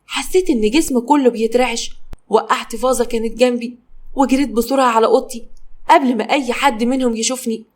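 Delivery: plain reading voice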